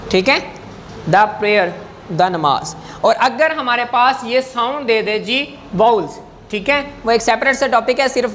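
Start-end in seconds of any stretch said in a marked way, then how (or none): 1.1-2.7 "the" said "da" before a consonant; "the" pronounced duh. Shown as a demonstration. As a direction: say "the" with an open uh vowel, not ee. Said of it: "تو ہم اس کو دا پڑھیں گے".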